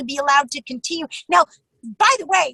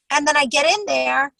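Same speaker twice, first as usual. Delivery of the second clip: usual speaking voice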